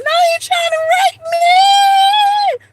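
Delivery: very high, loud, slow drawn-out shriek; panicked scream. Retold or imitated. Fleeing the man and screaming to mom, drawn-out shriek. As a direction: scream it long and frantic. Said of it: "I'm like running screaming".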